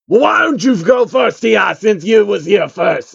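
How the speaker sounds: high, loud hammed-up rapper voice; over-the-top crunk shout. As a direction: yell an offer to go first.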